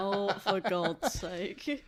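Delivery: laughing, drawn-out groan of disbelief; laughing exasperation. Real, not acted. Friend hearing a silly goose fanfic idea, laughing in exasperation. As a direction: laugh through the words, slow and loud.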